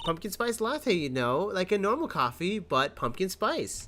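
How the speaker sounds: high, singsong witness explaining; coy April May. Acted. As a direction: lilt up high, stretching the last words.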